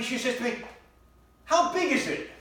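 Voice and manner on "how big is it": high, loud call from another room; mock wife yell. Wife yelling to husband about the dog's mess, high loud yell. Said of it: "she yells out to me".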